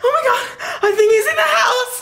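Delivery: high, panicked and near tears; terrified, voice climbing with fear; scared. Acted. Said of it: "I'm kinda scared".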